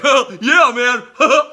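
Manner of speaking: very loud, high, goofy dude voice with a laugh; mock dumb dude. Ranter mocking the people he calls dummies, loud goofy high voice. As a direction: ham up a clueless party guy, way up high. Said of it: "the kind of people that are like".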